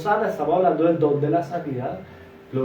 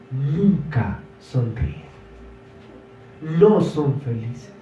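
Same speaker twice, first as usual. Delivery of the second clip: slow emphasis; much slower than usual. Real, not acted. Preacher slows for emphasis.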